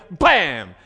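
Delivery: loud sudden bam shout; mock impact bam. Shown as a demonstration. Preacher acting out the ball hitting, loud bam. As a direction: slam the word out like a ball strike.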